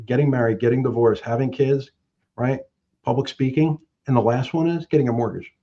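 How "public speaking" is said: ordinary delivery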